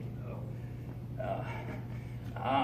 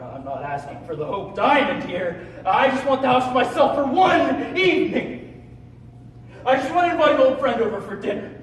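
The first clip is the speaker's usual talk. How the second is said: loud, high, frustrated appeal; exasperated pleading; 7 semitones higher, 11 dB louder than usual. Acted. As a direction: plead your case to mother at full volume. Pleading in quarrel with mother.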